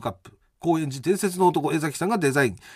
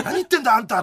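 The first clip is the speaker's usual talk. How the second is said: mock protest; 5 semitones higher, faster than usual. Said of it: "とか言って".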